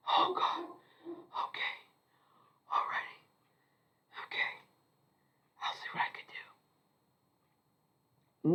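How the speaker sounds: hushed, breathy whisper, drifting off; defeated whisper. Retold or imitated. Andy resigns himself after the warning: whispered. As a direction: mutter it as if giving up.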